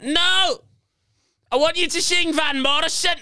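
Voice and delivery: high, insistent mock yell; put-on high heckler voice; retold heckler's screech. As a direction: demand it as an indignant heckler. Retold drunk heckler screams again.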